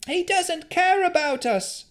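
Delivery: bitter townsperson. Acted. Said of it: "said another".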